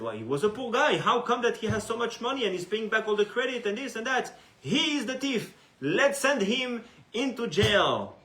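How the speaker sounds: loud, high accusing outburst; angry accusation. Acted. Townspeople loudly accuse the poor man of theft. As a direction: denounce him as the thief.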